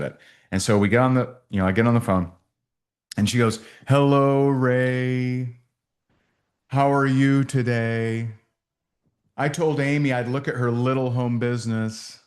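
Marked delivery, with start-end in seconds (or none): 3.9-12.2 condescending. Retold or imitated. Agency owner talking down to the speaker on the phone, condescending. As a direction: imitate a haughty, postured prospect, words stretched out. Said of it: "exactly what she said and exactly how she said".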